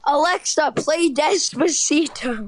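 loud slow command to Alexa. Real, not acted. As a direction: call out to the speaker, slow and loud. Commanding voice assistant loudly.

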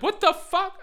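high, loud disbelief; acted shocked reaction. Woman re-enacting hearing his excuse, high incredulous cry. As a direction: exclaim it high in mock shock.

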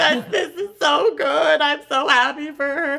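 high, tearful blubbering over good news; mock sobbing. Friend retells blubbering to Dee about the audition, mock sobbing. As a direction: imitate happy crying, voice pushed up. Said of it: "just, like, sobbing".

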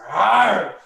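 short, loud, high growling yell; a hammed-up beast snarl; mock feral roar. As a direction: imitate the biter's animal roar. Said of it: "he fucking goes".

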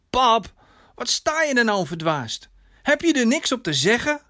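hogere, verontwaardigde dochterstem; gefrustreerde dochter. Acted. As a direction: confronteer je vader, hoog en geërgerd. Gefrustreerde dochter confronteert haar vader. (gefrustreerd, verontwaardigd)

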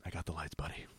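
very low, hushed imitation; softly imitating a reassuring buddy; low murmur. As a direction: say it deep under your breath, as if at bedtime. Retold lights-out line from Pete.

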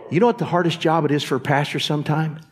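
usual speaking voice

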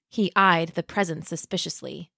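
usual speaking voice

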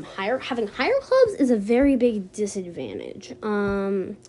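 ordinary talk, nothing marked

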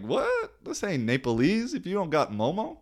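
high, incredulous "What?" then complaining; mock outrage. Telling the waiter in mock outrage, incredulous. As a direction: act stunned that there's no momo. Retold outrage at the missing dish.

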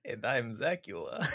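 soft, lightly vampiric intro; a hint of a count introducing himself; Zacula voice. Co-host introduces himself as the vampire Zacula, softly. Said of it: "His voice is easier to do".

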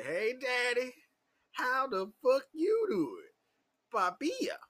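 high, drawn-out flirty greeting; flirty come-on. Retold or imitated. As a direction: coo it slowly up high, playing the flirt.

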